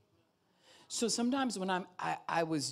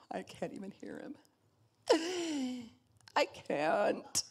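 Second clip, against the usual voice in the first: much higher, teary laughing voice; laughing-crying congregant; 13 semitones higher than usual. Retold or imitated. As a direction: act out someone laugh-crying. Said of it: "ask other people this".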